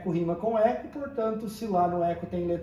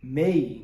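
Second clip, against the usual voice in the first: Mei said short and lower; stressed syllable; 6 semitones lower than usual. Shown as a demonstration. Teacher giving the answer on the strong syllable. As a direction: hit the syllable Mei firmly. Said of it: "qual que é a sílaba mais forte".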